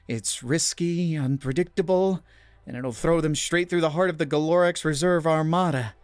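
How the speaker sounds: barely contain the thrill; manic energy. A narrator's reading. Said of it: "a manic energy buzzing in her voice".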